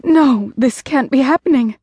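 high, frantic denial; horrified disbelief. Acted. Wife kneeling by her dying husband, panicked denial. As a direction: refuse to believe it, panicked.

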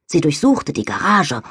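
plain reading voice

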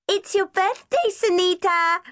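put-on high, loud child's yell; little girl yelling. A narrator's reading. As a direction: shout it like an excited five-year-old. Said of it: "yelled Debbie".

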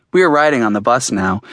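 ordinary delivery, nothing marked

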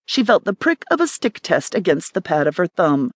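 plain reading voice

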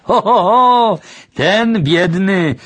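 high, loud drawn-out exclamation; exclaiming. A narrator's reading. Grandfather scoffs at the boy's question about rich folk, exclaiming. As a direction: call it out high, stretching the words. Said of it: "zawołał dziadek".

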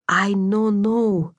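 put-on puzzled Slovak girl, words drawn out; Slovak accent, broken English. A narrator's reading. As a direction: say "I no know" haltingly in a Slovak accent. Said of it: "her Slovak accent soft and lilting".

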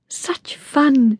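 put-on high-pitched girlish glee; thrilled, pitched well up; delighted squeal. A narrator's reading. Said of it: "squealed Vicky".

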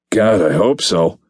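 slow, weary hungover moan. A narrator's reading. Hungover agent answering Alex with a slow moan. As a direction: drag it out like a groan. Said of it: "I moaned".

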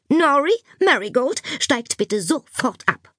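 teacher ordering pupils down, slowing for emphasis; stern order. A narrator's reading.

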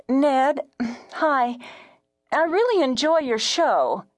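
high, tentative woman caller; timid caller pitched high; hesitant radio caller. A narrator's reading. Caller greets the host.

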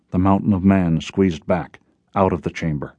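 usual speaking voice

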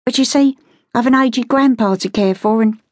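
high-pitched Cockney pleading; high, earnest beggar's plea; pleading beggar girl. Acted. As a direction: make a humble excuse in a high street-girl voice. Beggar girl pleads her case.

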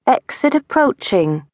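high British sat-nav voice; falsetto British accent. Acted. Ike as the GPS lady from the back seat, falsetto British accent. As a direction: announce it like a prim British GPS. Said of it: "The lady that lives in the G P S".

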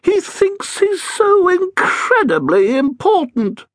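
mock the headmaster's vanity in the cranky ghost voice; high, sneering old ghost; sneering ghost Edith.

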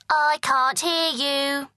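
put-on high, slow taunting child; a sing-song bratty boy. Prince taunting with fingers in ears.

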